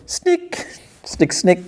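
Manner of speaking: sharp, high unvoiced 'snick'; imitated claw sound. Wolverine popping his claws, imitated sound effect.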